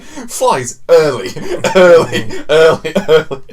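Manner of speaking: high, loud, slow repeats; sing-song it like a tannoy; announcer calling out the early flight; mock airport announcement.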